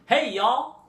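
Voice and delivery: high, loud Southern greeting; imitating a sister's Deep South holler; Southern US accent. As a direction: call out a Southern "Hey, y'all". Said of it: "deep South".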